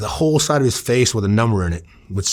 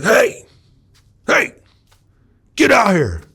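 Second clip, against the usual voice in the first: high-pitched shout toward someone inside; calling out; 11 semitones higher, 4 dB louder, slower than usual. Retold or imitated. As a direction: holler to get someone outside. Calling into dark apartment.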